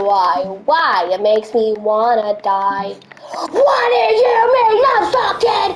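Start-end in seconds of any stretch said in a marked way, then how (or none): 3.3-5.8 high, loud, slow yelling rant; furious shout. Real, not acted. Raging at the stubborn game, high slow shouting. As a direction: scream at the car in real rage. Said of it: "I have gotta stop cursing".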